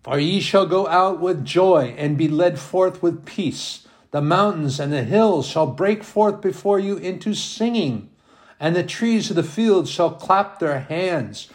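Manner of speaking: lifted, ringing reading of the verse; joyful solemn bible reading; solemn scripture reading. Acted. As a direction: let the verse soar a little. Reads scripture aloud.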